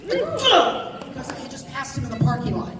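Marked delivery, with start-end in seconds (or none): 0.2-1.0 sudden high startled ugh; mock shocked ugh; shocked groan.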